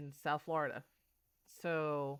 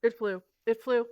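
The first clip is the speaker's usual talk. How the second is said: rattled; 5 semitones higher, 8 dB louder than usual. Real, not acted. Spider scare.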